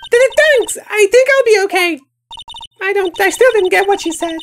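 put-on high, shy girlish voice; timid young girl. Overwhelmed sidekick apologizing, high girlish voice. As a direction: speak up high and bashful.

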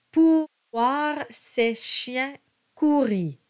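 slow, careful French: monta with a nasal O; slow French model. Shown as a demonstration. Coach reads the verse slowly for students. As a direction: sound out each French syllable. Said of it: "the word ses".

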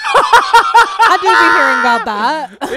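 laughing through a slangy aside; playful laughing drawl. Real, not acted. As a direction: giggle out the words. Jokey slang repeat, laughing.

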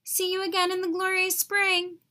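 high, lilting goodbye; singsong farewell. A narrator's reading. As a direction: sing it as you fly away. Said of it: "the bird sang".

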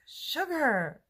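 bright, high, loud one-word answer; coworker's answer. Retold or imitated. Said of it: "And she's like".

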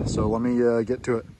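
normal talking voice

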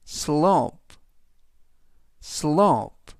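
slope, said carefully with one long vowel; teacher modelling slope; model pronunciation. Shown as a demonstration. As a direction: say slope clearly for repetition. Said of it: "slope라고 발음하시면 되겠습니다".

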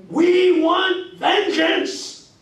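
high, loud, drawn-out preacher cry; mock preacher shout. Lecturer mimicking the preacher on television. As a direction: bellow it like the TV preacher. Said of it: "I can still hear him".

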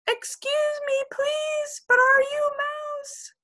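very high, eager kitten. Acted. Kitten Max calls up to the birds, very high. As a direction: ask up into the tree as a small kitten.

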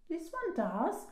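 put-on high-pitched child's protest; crying out. A narrator's reading. Daisy contradicts Dad about rhinos, crying out. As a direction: insist in a high little voice. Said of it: "cried Daisy".

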